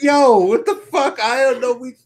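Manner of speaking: high, laughing panicked babble; mock panic. Host acts out meeting the wrestler in a hall, comic panic.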